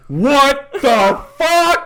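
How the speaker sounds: high, loud, slow drawn-out disbelief; imitating shocked bystanders. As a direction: stretch it out in stunned shock.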